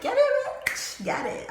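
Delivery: high, loud mock cheering yell. Cheering the couple on, a high mock scream. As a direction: shriek it like a hype cheer. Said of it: "I'm yelling and I'm screaming".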